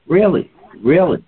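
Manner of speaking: high, repeated disbelieving really; imitating the doctor's surprise; disbelief. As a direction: echo her astonished disbelief.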